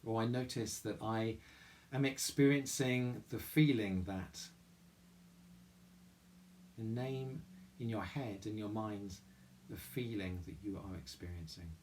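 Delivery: soft, calm meditation voice with long pauses; guided meditation. Real, not acted. Chapel leader guiding listeners through mindful breathing, guided meditation. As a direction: guide them gently inward, leaving room to breathe.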